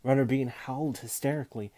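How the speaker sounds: ordinary delivery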